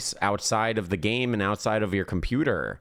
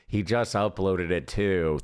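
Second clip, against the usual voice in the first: ordinary delivery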